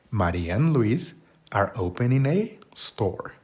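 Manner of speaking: slow, careful dictation pace; slow model sentence. Shown as a demonstration. Teacher modelling the sentence for Spanish learners, slowly. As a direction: lay out each word slowly for learners.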